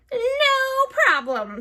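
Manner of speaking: high, loud and slow witch; drawn-out hag answer. A narrator's reading. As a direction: stretch it out smugly, very high. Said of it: "said Gritch".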